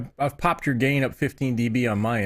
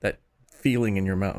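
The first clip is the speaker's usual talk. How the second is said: ordinary delivery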